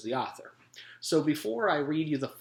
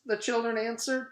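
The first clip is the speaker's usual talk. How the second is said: usual speaking voice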